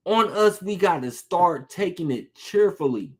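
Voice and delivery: usual speaking voice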